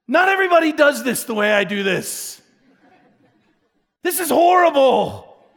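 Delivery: mock horror with a laugh; laughing dismay. Real, not acted.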